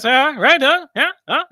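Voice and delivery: high, loud sidekick prompting; talk-show sidekick. Acted. Host imitating a late-night sidekick, eager prompting. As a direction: egg the host on with loud, high, rising huhs. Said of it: "where you go like this".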